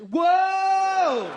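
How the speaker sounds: high, loud, stretched whoa; sudden drawn-out holler; long shouted whoa. Acted. Sudden climax in impression.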